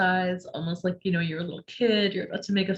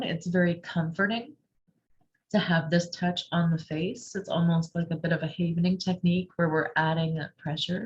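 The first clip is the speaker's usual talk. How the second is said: usual speaking voice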